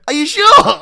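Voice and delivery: squeaky high question through laughter; laughing put-on voice. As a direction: crack up mid-line. Mock local voice while laughing.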